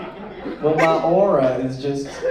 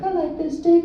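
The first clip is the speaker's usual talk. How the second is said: girlfriend voiced; 12 semitones higher than usual.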